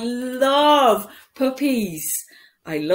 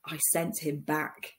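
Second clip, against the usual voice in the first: ordinary delivery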